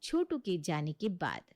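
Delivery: ordinary talk, nothing marked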